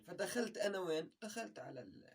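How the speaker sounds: normal talking voice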